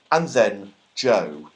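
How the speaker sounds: plain reading voice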